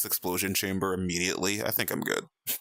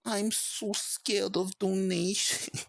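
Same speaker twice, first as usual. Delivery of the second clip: higher, slow hammed-up fright; theatrical fake fear, drawn out; mock scared; 10 semitones higher, much slower than usual. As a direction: play at being terrified of donations. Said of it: "I don't know where that came from".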